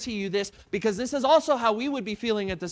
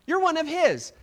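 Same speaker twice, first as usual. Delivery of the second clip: accusing servant woman; 6 semitones higher than usual. Acted. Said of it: "one of those ladies that came by and said".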